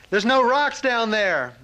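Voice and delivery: high, drawn-out call; retold yelling. Master and student over thunderous waterfall, retold yelling. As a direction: holler it slowly over the roar. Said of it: "the master screams at me".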